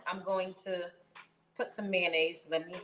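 ordinary talk

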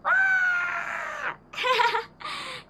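high delighted shriek; excited shriek. Real, not acted. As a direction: shriek with joy at the gift. Opening favourite character towel.

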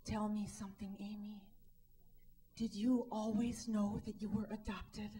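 normal talking voice

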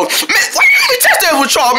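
high, wailing protest; whiny complaint. Acted. Acted sulk about being left out.

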